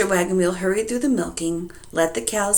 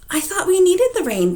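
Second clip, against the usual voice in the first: mild wife; 12 semitones higher, 5 dB louder than usual. Acted. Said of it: "said Missus Wagon Wheel".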